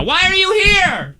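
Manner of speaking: high, slow, exaggerated words; through a window. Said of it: "they're talking through a window right now".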